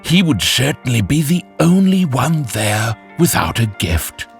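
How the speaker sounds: ordinary delivery, nothing marked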